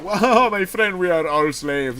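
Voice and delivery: hint of a smug boss laughing off the complaint; lightly voiced amused boss. Boss joking back at him.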